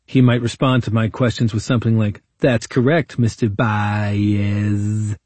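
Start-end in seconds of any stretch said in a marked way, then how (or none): 2.4-5.2 drawn-out, slow mocking name. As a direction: stretch the name out like it's silly. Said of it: "mocking my name as best he could".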